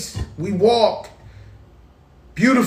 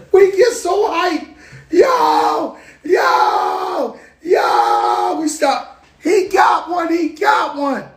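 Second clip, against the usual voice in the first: shouting, much higher, laughing; hyped shouting; 9 semitones higher, 3 dB louder, much slower than usual. Retold or imitated. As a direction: scream with hype in the studio.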